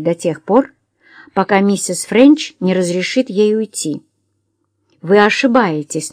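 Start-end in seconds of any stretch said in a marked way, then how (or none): none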